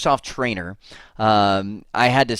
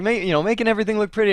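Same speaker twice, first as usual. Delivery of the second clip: high, rushed mock protest; retold defensive protest; 8 semitones higher, much faster than usual. Technician telling his boss about the assigned job, high and defensive. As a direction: imitate a technician hurriedly justifying himself.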